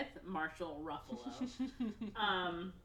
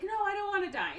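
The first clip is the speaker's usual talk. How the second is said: high, louder pleading cry; acted protest; 14 semitones higher, 6 dB louder, faster than usual. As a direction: plead for your life, hammed-up. Mickey protests to his clone.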